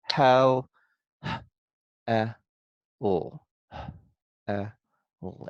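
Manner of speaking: "hel" then its sounds "eh", "l" in isolation; sounding out. Shown as a demonstration. Teacher works on the first syllable, sounding out. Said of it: "the first syllable".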